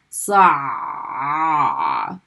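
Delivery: Sa rolled from deep in the throat; Arabic ayn, rolled in the throat; throaty ayn. Shown as a demonstration. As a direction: press Sa from the Adam's apple. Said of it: "feel that ah, that rolling sound".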